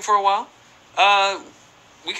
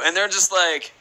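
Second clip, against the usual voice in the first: ordinary delivery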